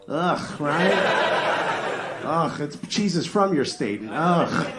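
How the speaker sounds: playful theatrical grossed-out rant; mock disgust. Real, not acted. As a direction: groan in fake disgust. Mock disgust at Milwaukee provolone.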